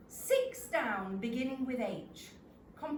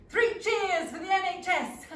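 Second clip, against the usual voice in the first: raised, loud cry of support; high and loud, hurrahing; rousing cheer; 8 semitones higher, 9 dB louder than usual. Acted. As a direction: cheer it out like a toast.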